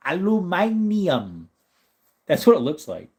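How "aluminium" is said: high, slow British "Aluminium", al-u-MY-ni-um; hammed-up British accent on "Aluminium"; mock British. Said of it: "So you gotta do it".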